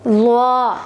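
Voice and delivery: ज़्वा said full-mouthed and thick; heavy zwa. Shown as a demonstration. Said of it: "ज़्वा की अदायगी भी आप ऐसे करेंगे कि मुंह भर के". (ज़्वा मुंह भर के, मोटा)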